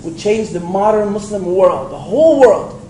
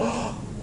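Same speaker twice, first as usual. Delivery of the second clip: mock gasp; 6 semitones higher, 13 dB quieter than usual. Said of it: "She didn't go".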